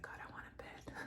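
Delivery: whispered longing prayer. Retold or imitated. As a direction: breathe the wish softly. Longing for a bed.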